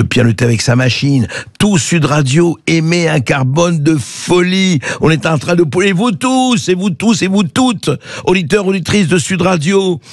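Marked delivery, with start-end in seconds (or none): 1.6-10.0 high, sing-song alarmed announcement; mock grand proclamation. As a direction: ham it up like a town crier.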